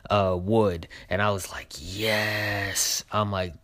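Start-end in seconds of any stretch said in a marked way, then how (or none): 1.8-3.0 long, drawn-out approving yes; thrilled yes. Retold or imitated. Fan reacting to a favorite trailer song, thrilled. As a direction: stretch it out, savoring the moment.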